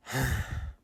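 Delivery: deep sad sigh; low sigh. Acted. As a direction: let out a tired sigh. Jim feeling sorry.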